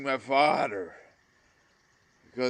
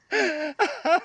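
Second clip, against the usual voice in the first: high sobbing gasp; a real sob catching in the throat; 3 dB louder than usual. Real, not acted. Crying at brother's memory.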